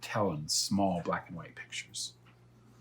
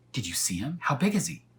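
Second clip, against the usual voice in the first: rising, eager higher questions; excited boy; 5 semitones higher, 3 dB louder than usual. Acted. Elmer eagerly asking about the dragon, higher and louder. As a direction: burst out with wonder.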